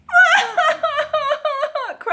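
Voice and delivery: high hammed-up wail; little girl crying. A narrator's reading. Little girl cries at the squeaky bed, mock wailing. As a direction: bawl in pulses like a storybook child. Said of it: "cried the little girl".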